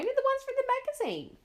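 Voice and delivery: put-on very high, eager little girl; child nudging a forgetful mum. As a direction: push it out like an impatient kid. Child insisting to mother.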